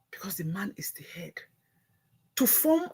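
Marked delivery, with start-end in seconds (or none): 0.1-1.3 whisper. Real, not acted. Vlogger hushing to stress that the man leads. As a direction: drop to a hush for the key line. Sudden whisper after shouting.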